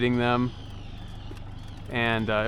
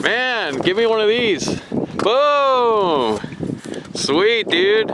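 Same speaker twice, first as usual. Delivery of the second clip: fighting a fish; 6 semitones higher, slower than usual. Real, not acted. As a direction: hype up the catch. Cheering while the fish fights.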